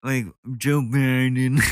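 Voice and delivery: a light Muppet-style parody; muppet-like mockery. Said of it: "Is he a Muppet?".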